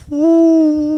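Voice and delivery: high, loud "Woo-wee" of alarm; startled high whoop; alarmed exclamation. Retold or imitated. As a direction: squeal it out like you just saw trouble coming. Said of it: "Now I'm like".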